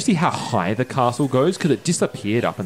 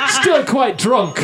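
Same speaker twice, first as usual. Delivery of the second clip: laughing; 4 dB louder, slower than usual. Real, not acted. Laughing in-character aside.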